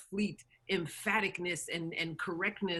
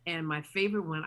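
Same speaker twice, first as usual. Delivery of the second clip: ordinary talk, nothing marked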